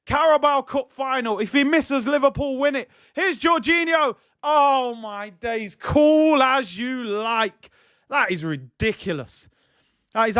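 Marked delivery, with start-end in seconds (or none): 0.1-7.7 tense and higher, bursting into a roar; real rising suspense then release; building excitement. Real, not acted. Tension builds to Jorginho's hop penalty.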